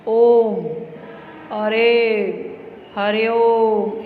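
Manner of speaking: drawn-out pluta vowels. Shown as a demonstration. Teacher showing children the triple-length vowel, drawn out loud. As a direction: stretch each vowel out long and loud. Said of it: "से तीन गुना अधिक समय लगता है".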